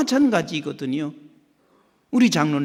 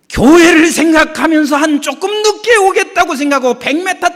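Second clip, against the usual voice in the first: loud, higher preacher's exhortation; fervent preaching; 11 semitones higher, 11 dB louder than usual. Acted. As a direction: preach it to the congregation. Preaching at full force.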